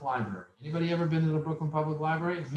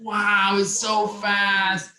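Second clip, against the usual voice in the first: louder, drawn-out slow wow of wonder; amazed wow; 5 semitones higher, 6 dB louder, much slower than usual. Retold or imitated. Teen marvels at his first computer, slow and awed. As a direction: savor the amazement slowly.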